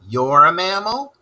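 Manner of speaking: usual speaking voice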